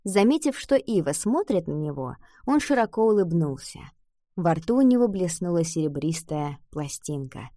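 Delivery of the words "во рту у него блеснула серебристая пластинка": ordinary delivery, nothing marked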